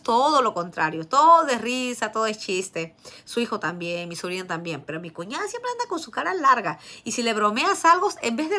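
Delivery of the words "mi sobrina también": ordinary talk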